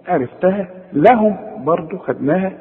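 normal talking voice

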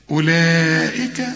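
normal talking voice

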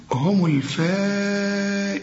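هم الفائزون slow, the madd held; obligatory madd. Shown as a demonstration. Teacher demonstrating madd in الفائزون. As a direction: draw out الفائزون. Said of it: "المد الواجب".

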